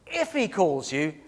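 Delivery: "If" drawn out and set apart, slow; stress on IF. Acted. Eli doubting God will call again, slow stress on "If". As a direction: lean slowly on "If" to show doubt. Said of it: "There's doubt by Eli".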